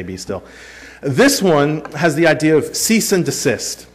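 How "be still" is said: hushed repeat. Shown as a demonstration. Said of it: "there's a lot of ways you can say".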